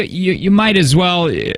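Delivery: ordinary talk, nothing marked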